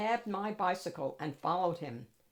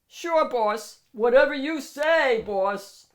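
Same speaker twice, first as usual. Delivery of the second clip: loud, eager yes-man bulldog; bulldog bouncer; 5 semitones higher, 11 dB louder, slower than usual. Acted. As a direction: snap to it for the boss. Bulldog bouncer obeys boss.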